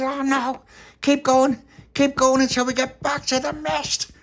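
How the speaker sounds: high, loud panicked urging; Bobby shouting to keep rowing; urgent shout. Acted. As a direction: push them to keep going.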